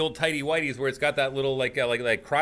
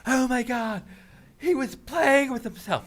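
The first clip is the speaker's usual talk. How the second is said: mock scandalized woman; 13 semitones higher than usual. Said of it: "they're like".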